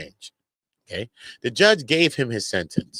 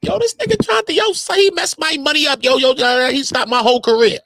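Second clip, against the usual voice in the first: mock outraged witness. Mock witness protesting career claim.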